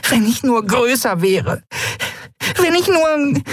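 very high, upset little fairy; a small winged girl near tears with anger; tiny fairy voice. Acted. Tiny fairy Nelen speaking.

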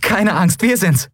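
high, happy, reassuring call; jubilant reassurance. A narrator's reading. Endriel telling nervous Miko it's them, high glad voice. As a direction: reassure him, full of joy. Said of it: "jubelte Endriel".

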